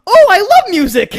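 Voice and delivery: put-on very high, loud excited kid voice; naive little sister. Little sister answering the mixtape seller, excited squeal. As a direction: gush like a delighted little girl. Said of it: "My sister goes".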